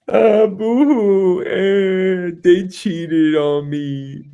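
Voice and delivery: high, slow blubbering whine; mock whining crybaby. Mocking a cheated guy's sobbing complaint, high and slow. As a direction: whimper like a dumped man.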